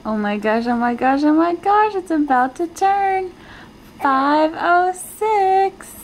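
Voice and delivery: rising thrill as the minute arrives. Real, not acted. Minute ticking to 24 hours.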